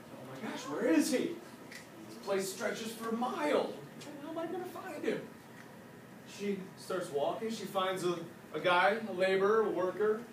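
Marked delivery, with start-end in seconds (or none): 0.1-5.2 higher-pitched, mildly panicked wondering; panicking. Acted. Wife searching the Great Wall for her husband, panicking. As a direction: voice climbs into a worried question. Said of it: "She started panicking".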